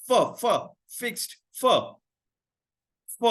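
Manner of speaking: फ repeated as a short breathy f sound; isolating the F sound. Shown as a demonstration. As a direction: break फिक्स्ड down to फ, फ. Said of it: "फिक्स्ड का sound तो".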